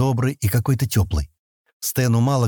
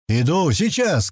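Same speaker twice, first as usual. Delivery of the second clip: raised, slow calling voice; called-out reply; 6 semitones higher, slower than usual. A narrator's reading. Sten answering a cry for help, calling out. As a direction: call back, pitched up and drawn out. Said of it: "крикнул Стэн".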